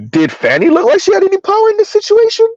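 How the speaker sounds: very high, squeaky-pitched disbelief; incredulous. Real, not acted. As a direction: challenge them with a rising, disbelieving question. Incredulous rhetorical question rebutting commenter.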